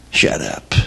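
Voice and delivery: breathy, barely voiced "Shut up." with a soft "up"; mumbled shut up. Shown as a demonstration. Boston comic showing off his favorite saying, quietly. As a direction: throw away "Shut up." almost under the breath. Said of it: "My favorite saying is".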